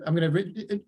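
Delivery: ordinary talk, nothing marked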